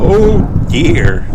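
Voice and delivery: startled by the thunder; startled gasp. A narrator's reading. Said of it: "gasped Grandpa Pig".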